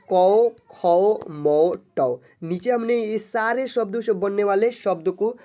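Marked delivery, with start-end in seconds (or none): 0.1-2.2 कौ, खौ, मौ, टौ, loud with a stretched "au"; syllable reading demo. Shown as a demonstration. Teacher reading au-matra syllables, loud and drawn out. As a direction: call out कौ, खौ, मौ, टौ one by one. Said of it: "इसको हम लोग पढ़ेंगे".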